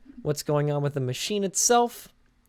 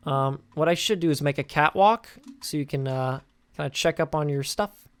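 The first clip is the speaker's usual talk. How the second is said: ordinary talk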